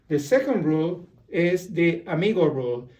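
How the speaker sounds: ordinary talk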